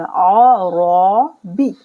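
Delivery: drawn-out heavy रॉ. Shown as a demonstration. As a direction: recite रॉ with a heavy full ra. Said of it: "और रॉ मोटा पढ़ेंगे".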